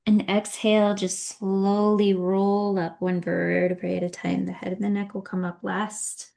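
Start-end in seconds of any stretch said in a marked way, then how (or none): none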